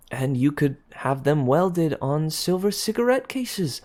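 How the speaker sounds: high, gushing excitement; bubbling over with a sudden idea; young woman thrilled by her clever plan; excited idea. A narrator's reading. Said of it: "whirled about at a sudden idea".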